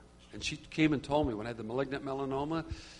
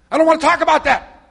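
much higher, very loud mock yell; imitated angry outburst; 12 semitones higher, 15 dB louder, faster than usual. Stressed woman cutting off the caller, imitated angry shout. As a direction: yell it as her abrupt refusal. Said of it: "Just like that".

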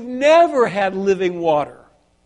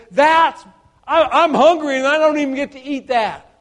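higher, louder, half-laughing complaint; laughing son's complaint, pitched up; mock lament; 5 semitones higher, 6 dB louder than usual. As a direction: whine it as the hungry son. Son voiced at pig slop.

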